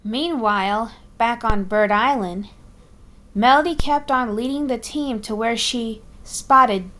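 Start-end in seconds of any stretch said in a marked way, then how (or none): none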